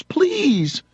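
high, drawn-out mock plea; imitated begging. Man begging the cop, high imitated pleading. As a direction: beg in a put-on high voice. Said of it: "doesn't like him begging him".

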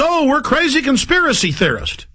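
high, mocking take on the news; mocking imitation. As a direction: sneer it high, parroting a dismissive accusation. Mimicking the news dismissing them.

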